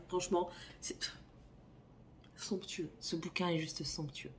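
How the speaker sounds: soft hushed praise, almost breathless; awed whisper. Real, not acted. Recommending Heartless to viewers, she whispers in awe. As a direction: whisper it like a treasured secret.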